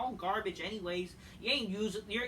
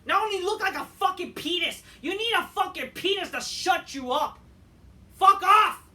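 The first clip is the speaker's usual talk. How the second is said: much higher, much louder raging yell; furious screaming; 11 semitones higher, 11 dB louder than usual. Real, not acted. Fan screaming insults at the TV, furious. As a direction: scream it with full rage.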